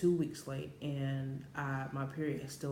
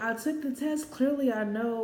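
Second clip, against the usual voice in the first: much higher, louder retold retort; exasperated comeback; 8 semitones higher, 5 dB louder than usual. Answering doubting boyfriend on the phone, exasperated. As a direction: act out indignant insistence.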